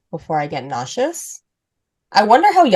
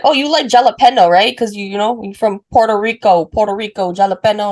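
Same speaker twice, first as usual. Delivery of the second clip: loud, high mock quiz of a foreigner; excited questioning host voice, hammed up; imitating locals; 4 semitones higher, 5 dB louder, faster than usual. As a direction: mimic the eager host asking, pitched up and loud. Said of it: "And I'm like".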